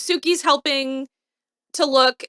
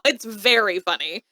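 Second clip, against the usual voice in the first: usual speaking voice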